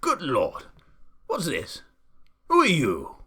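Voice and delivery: loud, astonished exclamations, broken apart; Duke gasping in shock. A narrator's reading. Duke staggered by boy in pelican's beak, astonished. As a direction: burst out in disbelief, building to a shout. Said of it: "he gasped".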